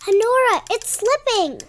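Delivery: high, loud urgent alarm. Acted. Little brother warning his sister the rope slips, alarmed. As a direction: cry out as it slips away.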